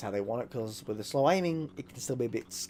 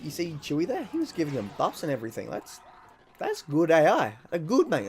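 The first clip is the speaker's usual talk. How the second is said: ordinary talk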